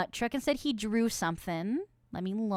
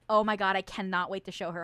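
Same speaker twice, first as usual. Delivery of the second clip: ordinary delivery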